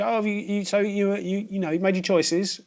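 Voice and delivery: charity shop lady voiced high and gentle; sweet old lady. Imitating shop lady's voice.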